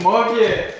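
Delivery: loud call across the yard; shouting a name. Acted. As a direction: yell the name outward. Shouting for missing brother.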